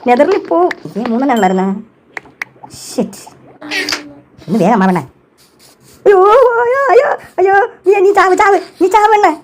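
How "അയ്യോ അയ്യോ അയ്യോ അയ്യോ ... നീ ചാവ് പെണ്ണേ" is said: high, frantic cries at her teammate, cursing her; panicked yelling. Real, not acted. Yelling at the girl who keeps dying, panicked. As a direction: shriek it in game panic.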